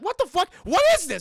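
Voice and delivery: high, loud panicked outburst; startled shout. Retold or imitated. Streamer voicing panicked shoppers, a high loud startled yell. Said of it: "They're like".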